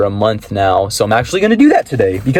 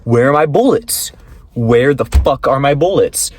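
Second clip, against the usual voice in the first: 1 baffled outburst; 3 semitones higher than usual. Retold or imitated. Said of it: "So I'm like".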